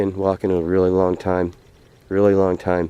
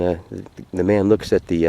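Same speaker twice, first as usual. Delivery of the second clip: usual speaking voice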